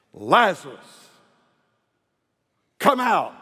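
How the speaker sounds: loud, high, slow summons; commanding shout. Acted. Jesus shouts to Lazarus at the tomb, loud and commanding. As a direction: call the dead man out with authority. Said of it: "Jesus shouts it".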